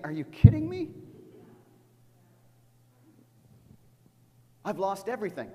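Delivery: high, loud, anguished disbelief; grieving widow's outcry. Retold or imitated. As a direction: cry out as the bereaved mother. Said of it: "you're saying".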